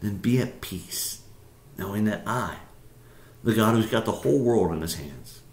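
soft, slowing, sinking to a hush; calm reassurance. Acted. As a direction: gently tell them to let go. God reassures, asks to let go.

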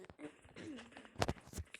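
rough throat clear. Real, not acted. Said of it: "Bademciğim şişmiş".

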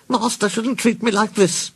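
put-on high-pitched grumbling old car; high, aggrieved storybook grumble. A narrator's reading. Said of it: "she grumbled".

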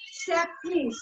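ordinary delivery

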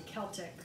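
"Celtic" starting with a K sound; hard C. Shown as a demonstration. Passing on a viewer's answer, "Celtic" with hard C. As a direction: say "Celtic" as KELL-tick. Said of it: "it's a hard C".

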